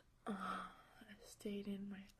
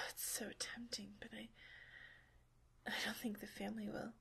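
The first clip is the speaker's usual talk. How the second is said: hushed, close whisper held line after line; intimate whisper; 5 dB louder than usual. Acted. Partner whispering to her lover during a roleplay, intimate whisper. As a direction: keep it all whispered and close to the mic.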